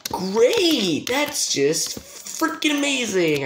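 high, drawn-out bitter cheer; sarcastic exasperation. Real, not acted. Gamer who fell to the beginning, high sarcastic exasperation. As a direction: stretch the praise out in a high sarcastic cry.

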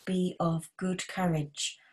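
ordinary delivery

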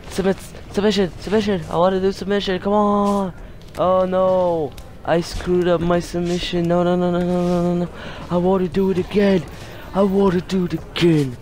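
high-pitched, repetitive begging; pleading protest. Real, not acted. Protesting a failed submission in game.

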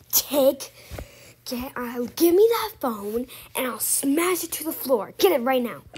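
furious demanding yell; angry shouting. Acted. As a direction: bark the order loudly. Babysitter demands the phone.

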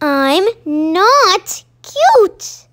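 a cross little owl insisting; mad protest. Acted. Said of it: "Baby Owl looked so mad".

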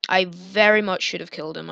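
usual speaking voice

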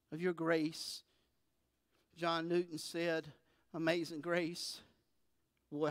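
soft, low, intimate pastoral prayer; quiet prayer. Real, not acted. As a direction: pray softly and sincerely to God. Pastor prays after the reading.

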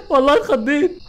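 laughing. Real, not acted. Laughing after a scare.

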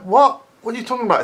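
can't believe what you're hearing; exasperated disbelief. Real, not acted. Exasperated reaction to videos.

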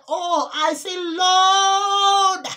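high, loud anguished wail of Lord; crying out. Real, not acted. Pleading for his grandmother in hell, anguished high wail. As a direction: cry out to God in anguish.

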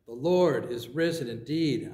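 ordinary talk

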